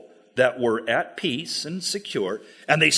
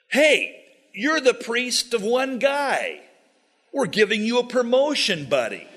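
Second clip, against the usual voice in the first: coaxing salesman; 7 semitones higher than usual. Acted. Danites coax the priest.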